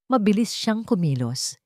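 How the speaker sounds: usual speaking voice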